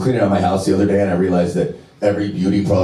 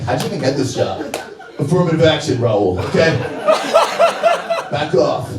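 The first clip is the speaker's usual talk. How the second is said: mock sassy retort; 7 semitones higher than usual. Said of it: "I was like".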